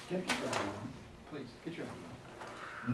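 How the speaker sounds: low, soft, private reminder; quiet correction. Shown as a demonstration. Teacher quietly asking student to wear his ID, soft. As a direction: say it quietly so only he hears. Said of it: "instead of".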